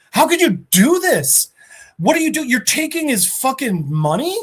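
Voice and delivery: high-pitched outraged confrontation; mock screaming. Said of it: "he starts fucking screaming at him".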